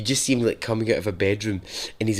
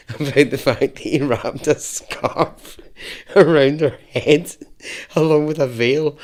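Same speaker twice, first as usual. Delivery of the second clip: helpless laughter through the words; can't stop laughing; 4 semitones higher, 4 dB louder than usual. Real, not acted. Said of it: "I can't stop laughing".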